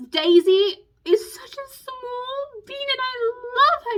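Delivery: very high, slow, drawn-out squeal of fondness; real gushing adoration. Real, not acted. Fan gushing over her favourite fictional girl, adoring squeal. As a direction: coo it high, stretching the words.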